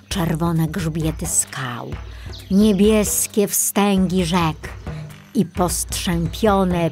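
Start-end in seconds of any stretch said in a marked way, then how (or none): none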